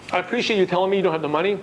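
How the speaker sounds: rushed, quick confident line; said really fast. Retold or imitated. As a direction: rattle it off quickly. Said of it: "You said those three sentences really fast".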